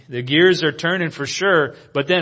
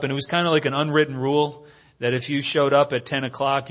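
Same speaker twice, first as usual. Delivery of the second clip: ordinary talk, nothing marked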